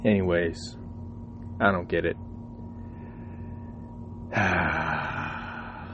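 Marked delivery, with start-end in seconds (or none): 2.2-4.3 long, soft exhale; soft, drawn-out sigh; weary sigh. Real, not acted. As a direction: let out a long fed-up sigh. Fed up about the mouse.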